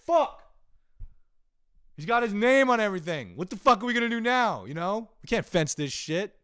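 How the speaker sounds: high, loud mock yell of a foiled thief; frustrated burglar yelling. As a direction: burglar shouting in comic despair. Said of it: "I'm fucking yelling".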